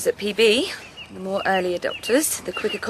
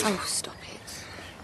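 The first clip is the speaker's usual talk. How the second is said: hushed plea; 11 dB quieter, much slower than usual. Acted. Daughter hushing father's gloomy jibe.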